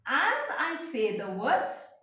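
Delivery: ordinary talk, nothing marked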